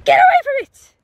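high, loud shout; sharp call-off. Real, not acted. Owner calling dog away from a stingray, sharp shout.

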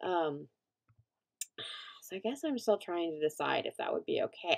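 usual speaking voice

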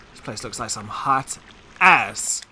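ordinary talk, nothing marked

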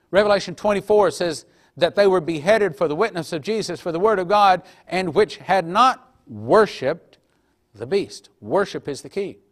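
preacher declaiming Revelation to brothers and sisters, full force; full-force preaching. Acted. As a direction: thunder the verses at the congregation. Reads scripture at full preaching force.

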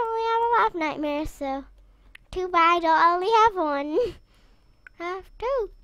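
ordinary talk, nothing marked

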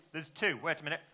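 usual speaking voice

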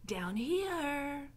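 lightly voiced rat, singing out the last word; Roscuro. A narrator's reading. Rat calling up to Mig, drawn-out voice. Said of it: "said Roscuro".

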